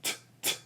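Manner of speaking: T-, t- as a soft voiceless puff; sounding out T. Shown as a demonstration. Speech host models the T sound for kids. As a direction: breathe out the t- sound without voice. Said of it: "Can you say tree?".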